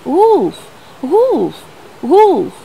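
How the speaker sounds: loud cry of wolf; boy shouting wolf. A narrator's reading. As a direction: call out for help. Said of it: "the shepherd boy again shouted".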